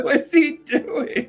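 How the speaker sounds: high laughing echo; laughing disbelief. Real, not acted. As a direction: repeat it while laughing. Echoed laughing question.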